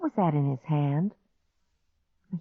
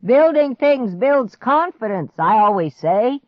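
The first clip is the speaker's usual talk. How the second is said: yelling; 7 semitones higher, 8 dB louder than usual. Acted. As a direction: shout the motto proudly. Grandpa yelling from afar.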